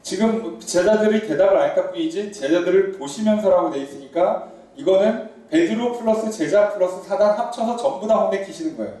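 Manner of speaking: ordinary talk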